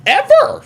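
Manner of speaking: EVER shot up high, rising; stressed ever. Real, not acted. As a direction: squeal the word ever. Emphatic admission.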